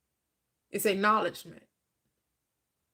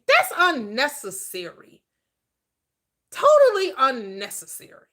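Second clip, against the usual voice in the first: emphatic rebuke; 3 semitones higher, 6 dB louder than usual. Acted. Preacher rebukes bargaining.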